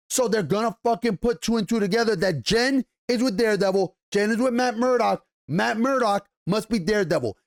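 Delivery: ordinary delivery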